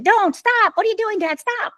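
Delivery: high, thin panicked pleading; imitating her young self begging dad to stop; frightened child pleading. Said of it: "I just stood there saying".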